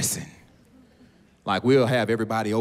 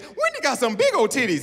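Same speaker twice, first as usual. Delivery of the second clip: put-on high-pitched child blurting it out; high little-kid voice; imitated toddler; 12 semitones higher than usual. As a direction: do a toddler tattling, much higher. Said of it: "the baby said".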